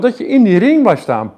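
ordinary talk

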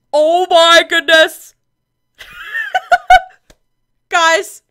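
loud, high gasp and laugh of delight; real excitement. Real, not acted. Reacting to viewers as a second baby arrives, overjoyed. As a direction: let the joy burst out. Twins being born.

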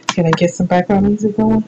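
ordinary delivery

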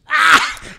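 sudden loud high yelp of recognition; excited yelp. Real, not acted. Friend hearing the inspector story yells out in recognition.

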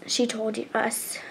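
ordinary delivery

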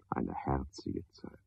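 whispered, slow and low; hushed, intimate whisper. Acted. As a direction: breathe it out in a whisper. Musing alone at night.

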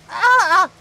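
short high wail; sudden high cry. Real, not acted. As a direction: yelp out in distress. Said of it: "hear [name] cry over Wreckfest".

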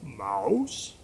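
low, rising call; mysterious frog voice. Acted. Unseen magic frog calls to the mouse, low mysterious voice. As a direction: call out in a deep frog's voice. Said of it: "And the voice said this".

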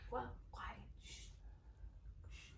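whispered hush. Real, not acted. Hushing a baby while sister sleeps, whispered. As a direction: breath-only whisper to hush.

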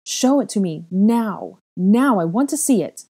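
loud high insistent demand. A narrator's reading. Girl in tears demanding to see the mark, loud.

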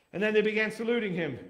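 ordinary delivery

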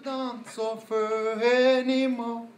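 put-on slow, stiff English with a German accent; German accent sung slowly; German-sounding accent. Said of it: "on aurait dit, euh, un Allemand".

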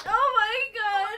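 loud, high wail of joy; overwhelmed cry. Real, not acted. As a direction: wail it out, overcome with happiness. Overwhelmed by the announcement.

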